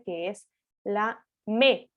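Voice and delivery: me drawn out slowly as the stressed syllable. Shown as a demonstration. Teacher points out the stressed syllable of México, slowly. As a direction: lean on me slowly to mark the stress. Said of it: "tónica identificada a través de su tilde".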